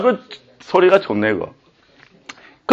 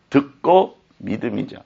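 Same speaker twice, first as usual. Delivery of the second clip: usual speaking voice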